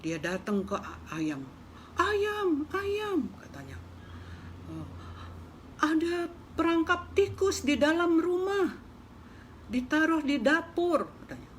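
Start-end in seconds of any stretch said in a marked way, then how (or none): 2.0-3.2 very high squeaky little mouse calling out. Acted. Mouse calling out to the hen, high and squeaky. 4.7-11.1 high, anxious little mouse. Acted. Mouse warning the hen about the trap, high and urgent.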